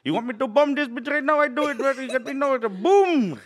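very high, loud mock foreign accent; hammed-up shrieking store clerk; 7-Eleven clerk. Shown as a demonstration. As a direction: blow up into a high-pitched accented rant. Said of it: "I got seven eleven down".

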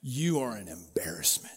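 queen whispering scorn at her dancing husband; scornful whisper. Retold or imitated. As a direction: hiss it with contempt. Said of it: "And she says".

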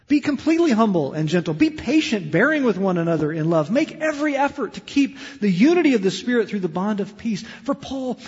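high, loud preaching voice. Acted. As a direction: proclaim it from the pulpit. Reads scripture with force.

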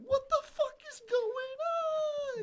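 high, slowed-down shriek of shock; stunned yell. Retold or imitated. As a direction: scream it in total disbelief. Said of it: "and I'm just like".

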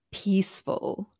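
usual speaking voice

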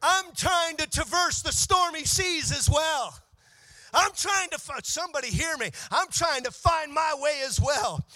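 high, loud, shouted preaching with musical lift; full-force preaching. Acted. Pastor shouting over the organ to the congregation, full-force preaching. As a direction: preach it hard, building to a roar.

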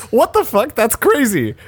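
high-pitched imitation of someone amazed; mimicking a friend's amazed outburst; mock astonishment. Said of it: "And you went".

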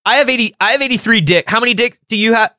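high, silly barista voice; goofy barista voice. Pretend. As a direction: play a dim cheerful barista. Barista voice in sketch.

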